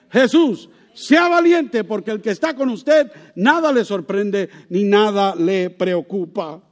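loud, rising exhortation; rally them to be brave; fiery preaching. Acted. Preacher urges the congregation to courage, building to loud preaching.

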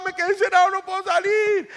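high, loud cry for help; child yelling. Acted. Locked-in boy calling to his mother, yelling. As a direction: wail it out like a trapped child. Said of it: "si el niño está gritando".

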